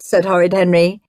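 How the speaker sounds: ordinary delivery, nothing marked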